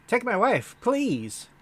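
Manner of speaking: high, loud one-liner delivery; hammy comic. Acted. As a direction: sell the old joke to the crowd. Comedian character delivers a one-liner.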